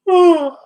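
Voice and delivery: loud high sigh that falls away; heavy sigh. Real, not acted. Mother overwhelmed by her own judgment, heavy sigh. Overwhelmed while processing guilt.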